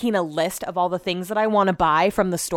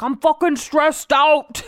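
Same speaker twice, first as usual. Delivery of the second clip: slow, mock English accent, pitched up; [name] in English accent; 6 semitones higher, 3 dB louder, slower than usual. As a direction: do a bad English accent of a stressed partner. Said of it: "It's the worst accent ever".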